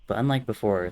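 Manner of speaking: ordinary delivery, nothing marked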